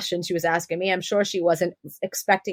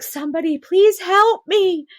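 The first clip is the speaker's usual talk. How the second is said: much higher, desperate plea; imitating her own desperate cry for help, higher and louder; pleading for help; 10 semitones higher, 4 dB louder than usual. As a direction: beg for help as the pain comes. Pleading during a strong contraction.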